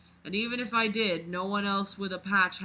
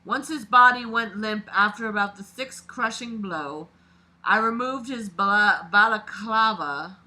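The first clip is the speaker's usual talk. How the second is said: reading a dark first-person story aloud, a bit louder; dramatic read-aloud of a creepy story; 6 dB louder than usual. A narrator's reading. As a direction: narrate it like a confession being read out. Reads the killer's story aloud.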